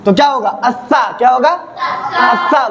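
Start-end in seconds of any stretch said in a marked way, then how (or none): 0.5-1.0 अस्सा with a fine, light hissed s and open "aa"; thin whistling s. Shown as a demonstration. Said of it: "महीन, महीन आवाज में". 1.5-2.7 अस्सा with a fine, light hissed s and open "aa"; thin whistling s. Shown as a demonstration. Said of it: "महीन, महीन आवाज में".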